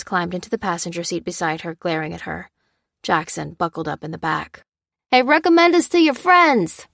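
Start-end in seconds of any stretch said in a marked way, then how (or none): 5.1-6.9 high, louder call to a departing car; calling after. A narrator's reading. Red Hat calling after Lucy as she pulls away, loud and high. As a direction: shout a cheeky goodbye as they drive off. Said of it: "called Red Hat".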